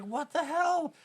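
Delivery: high-pitched mock disbelief; retold bafflement. Retelling own baffled reaction.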